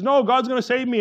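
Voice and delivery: high, loud, insistent brush-off; stubborn refusal. Retold or imitated. Man on the roof in a flood to rescuers, stubborn refusal. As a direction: call back a cocksure no.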